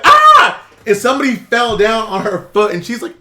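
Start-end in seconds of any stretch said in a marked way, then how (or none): 0.0-0.6 sudden high mock shriek, much louder; retold scream. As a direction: let out a short imitated scream.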